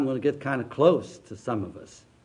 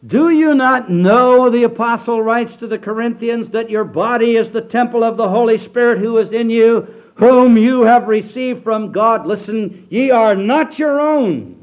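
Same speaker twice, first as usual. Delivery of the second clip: loud, high, slow pulpit proclamation; full-force revival preacher; preaching at full force; 7 semitones higher, 10 dB louder than usual. Acted. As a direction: thunder the scripture out slowly.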